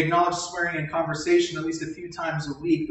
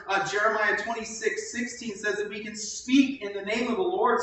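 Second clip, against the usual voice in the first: ordinary talk, nothing marked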